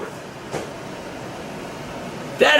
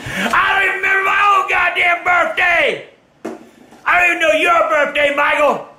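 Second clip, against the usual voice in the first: furious rant; 8 semitones higher than usual. Real, not acted. Grandfather ranting about the forgotten birthday, furious high shouting. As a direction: rant hard at the family.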